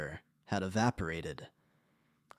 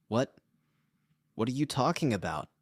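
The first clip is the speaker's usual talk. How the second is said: panicked stammer; 3 semitones higher than usual. A narrator's reading. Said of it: "she stammered, voice rising in panic".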